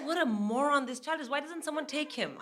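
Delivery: high, hushed exasperation; exasperated aside. Retold or imitated. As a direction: grumble softly about a hopeless child. Mother mutters about moron son.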